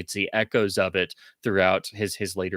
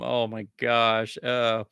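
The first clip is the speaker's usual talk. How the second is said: ordinary talk, nothing marked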